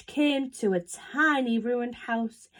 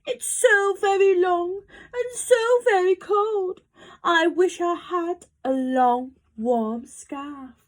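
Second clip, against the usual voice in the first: higher-pitched, mildly sorrowful giraffe; sad giraffe; 7 semitones higher than usual. A narrator's reading. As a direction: complain with a touch of self-pity. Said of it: "was sniffing sadly".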